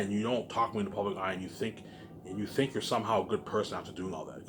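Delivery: ordinary talk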